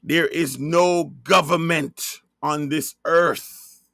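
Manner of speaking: ordinary talk, nothing marked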